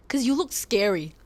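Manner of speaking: mock blunt classmate voice; imitating the blunt verdict; classmates' answer. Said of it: "their answer".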